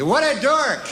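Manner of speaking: hammed-up headline cry; mock headline shout. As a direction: bark it like a headline.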